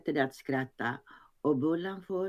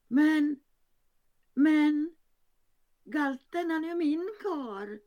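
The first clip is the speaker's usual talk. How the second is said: much higher falsetto cry, louder at first; falsetto shriek; 14 semitones higher, 4 dB louder than usual. Acted. Pinnen claiming the man as hers, falsetto shriek. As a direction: squeal it out high in falsetto. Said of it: "skriker pinnen i falsett".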